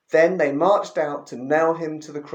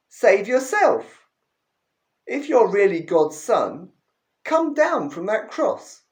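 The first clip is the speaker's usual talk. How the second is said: taunting, rising higher toward the end; jeering; 5 semitones higher, 4 dB louder than usual. Acted. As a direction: sneer at him from the roadside. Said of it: "jeered, shaking their heads in mock lament".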